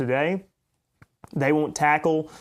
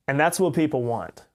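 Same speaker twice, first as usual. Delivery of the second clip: usual speaking voice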